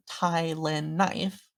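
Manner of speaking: "Tai Lin Knife" as three separate, slow words; name in separate words. Shown as a demonstration. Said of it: "I've always heard it".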